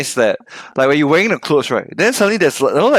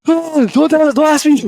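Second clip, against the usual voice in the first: high, pleading mock panic; high-pitched fake dread; 9 semitones higher, slower than usual. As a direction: squeal it like a terrified sufferer. Acting out scared phobia sufferer.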